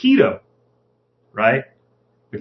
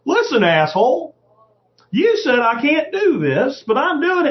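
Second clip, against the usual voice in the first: higher-pitched, defiant telling-off; defiant retort; 7 semitones higher than usual. Acted. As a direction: talk back to an official, pitched up. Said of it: "write a letter ... and say".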